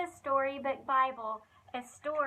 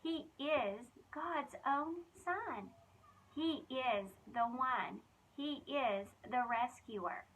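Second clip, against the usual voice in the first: slow solemn; 2 semitones lower, 4 dB quieter, slower than usual. Acted. Solemn announcement in story.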